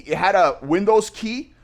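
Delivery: ordinary delivery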